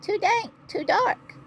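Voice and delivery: tiny, very high mouse squeak; squeaky mouse. A narrator's reading. Mouse complaining about the dark cave, squeaking. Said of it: "Mouse squeaks".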